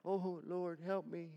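a timid little plea; understated quiet plea; soft half-hearted plea. Acted. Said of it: "I'm sure that was a".

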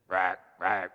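low imitated bullfrog croaks; frog croak. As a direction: croak twice like a grumbling frog. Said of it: "we're just croaking at him".

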